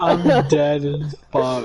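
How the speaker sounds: slow words through laughter; cracking up, barely getting it out; laughing. Real, not acted. Cracking up at friend's joke.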